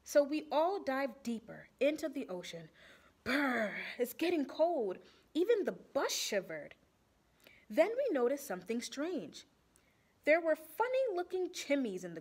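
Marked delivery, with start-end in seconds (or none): none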